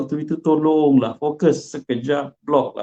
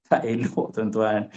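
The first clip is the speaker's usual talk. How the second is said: usual speaking voice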